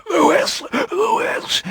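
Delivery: high breathless gasps; gasped over and over. Acted. Dying vampire gasps for Louis as she bites him. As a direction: gasp the name desperately, strangled. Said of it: "he gasped over and over".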